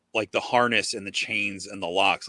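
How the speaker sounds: normal talking voice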